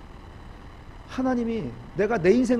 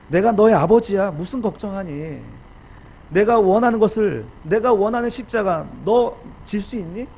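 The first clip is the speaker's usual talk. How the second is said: loud, raised, emphatic fatherly challenge; the Lord speaking; 3 semitones higher, 7 dB louder than usual. Acted. God questioning the discouraged preacher, loud and earnest. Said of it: "주님이 이렇게 말씀하시는 것 같아요".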